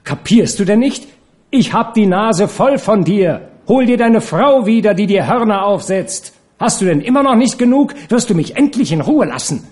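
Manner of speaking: high, loud, exasperated outburst; angry tirade. Acted. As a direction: lash out at a tiresome lover, high-pitched. Furious lovers' quarrel acted out.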